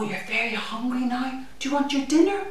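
ordinary delivery, nothing marked

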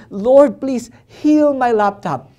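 loud plea, slowing down; begging. Retold or imitated. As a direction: cry out to God for help. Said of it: "And I begged God".

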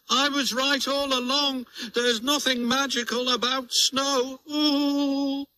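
very high, gleeful gloating voice. Acted. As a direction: crow over being right, giggling. Toad gloats, cackles.

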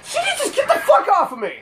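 high, quick frantic cry; real panicked outburst. Real, not acted. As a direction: shriek to shake off an attacker. Attacked in game.

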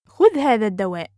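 quick, flowing and joined up; fast model sentence; natural speed. Shown as a demonstration. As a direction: run the phrase together fluently. Teacher repeats phrase at speed.

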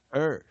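single strong curled-tongue er; emphasized er demo. Shown as a demonstration. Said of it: "这个er".

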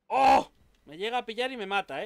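loud startled cry then tense; startled yelp. Real, not acted. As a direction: jump at a near miss. Nearly hit by enemy.

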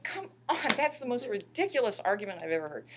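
high, loud "come on", then indignant; exasperated retort. Retold or imitated. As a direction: protest in disbelief at a silly argument. Exasperated retort to public's argument.

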